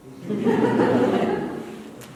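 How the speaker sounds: a short breathy laugh at himself. Real, not acted. Laughing at his own joke.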